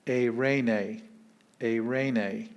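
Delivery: eirene as ay-RAY-nay; careful Greek eirene. Shown as a demonstration. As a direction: eirene in three syllables. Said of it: "three syllables".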